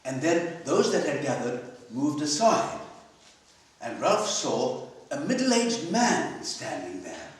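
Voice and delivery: usual speaking voice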